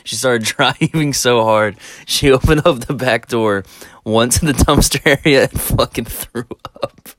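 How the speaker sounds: laughing while telling it; words broken by real laughter; real giggling through the story. Real, not acted. Laughing through the funny part.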